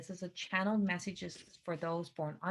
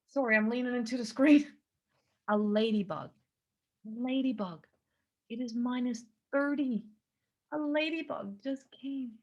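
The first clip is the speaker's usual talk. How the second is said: louder, higher, real delight at a ladybug; surprised excitement; 4 semitones higher, 6 dB louder than usual. Real, not acted. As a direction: blurt it out amazed. Ladybug lands on window.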